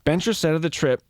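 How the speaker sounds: ordinary delivery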